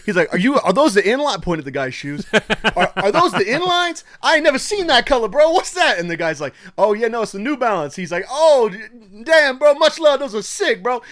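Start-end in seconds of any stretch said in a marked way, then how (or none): 0.3-6.0 much higher and louder, hyped up; excited stranger. Retold or imitated. Stranger excited about the shoes. 6.8-11.0 high, loud hype bro; hyped stranger. Retold or imitated. Exchange between two strangers.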